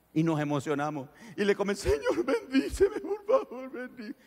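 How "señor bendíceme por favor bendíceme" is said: high, choked pleading; tearful begging. Acted. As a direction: beg through tears. Believers begging for blessing.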